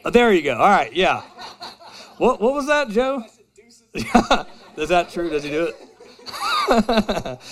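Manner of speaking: ordinary delivery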